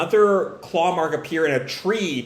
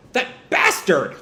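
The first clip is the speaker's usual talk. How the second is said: drawn-out, higher exasperated curse; indignant curse; 4 semitones higher than usual. Acted. Boruto finding the doppelganger gone, indignant. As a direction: curse him in mild outrage.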